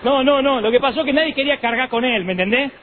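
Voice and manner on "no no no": ordinary delivery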